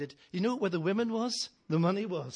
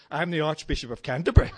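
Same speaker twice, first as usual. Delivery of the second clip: put-on louder, quick, half laughing as the cheeky boy; 2 semitones lower, 11 dB louder, faster than usual. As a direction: blurt it out through laughter. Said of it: "He says".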